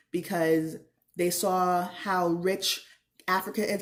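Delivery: ordinary talk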